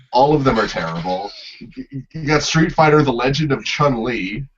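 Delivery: ordinary talk, nothing marked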